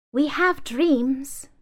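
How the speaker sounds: high, very slow doll voice; doll's dreamy reply. Acted. Doll answering the manifesto, high and very slow.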